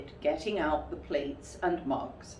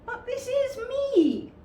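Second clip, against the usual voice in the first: much higher surprised cry; 6 dB louder than usual. Acted. Pooh telling Rabbit it is him, very surprised. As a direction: sound astonished. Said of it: "very much surprised".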